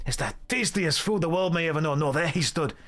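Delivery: affronted Scottish dwarf, then dramatic storyteller; hammed-up Scottish accent, building up the tale. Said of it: "That was a perfect Scottish accent".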